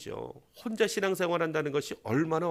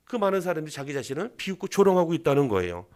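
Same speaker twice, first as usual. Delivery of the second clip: usual speaking voice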